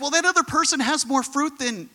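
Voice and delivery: high-pitched imitation of an envious believer; mock complaint. Preacher mimicking someone comparing their fruit to another's, mock complaint. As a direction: pitch it up as a whiny grievance.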